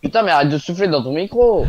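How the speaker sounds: exasperated yell; angry shout. Real, not acted. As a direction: snap loudly in real frustration. Real annoyance at mic noise.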